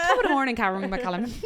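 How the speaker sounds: playful stage-Irish lilt; mock Irish accent. Greeting the makeup artist in a joking Irish accent. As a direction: ham up an Irish greeting to the name.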